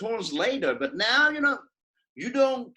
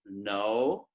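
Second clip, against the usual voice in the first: "no" starting very low and rising like a question; 12 semitones lower, 4 dB quieter than usual. Shown as a demonstration. Teacher proving English has tones, low rising "no". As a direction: ask "no" from low in the voice, sliding up. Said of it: "You have tone there".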